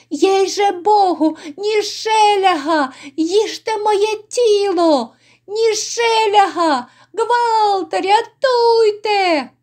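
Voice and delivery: very high cry, stretching the final call; desperate wail. Acted. Innkeeper under the whips crying for rescue, desperate high wail. Innkeeper being beaten, cries for help.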